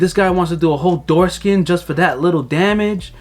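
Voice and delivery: mildly outraged, incredulous question; brother losing it. Retold or imitated. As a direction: imitate an indignant brother. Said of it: "my brother lost his shit".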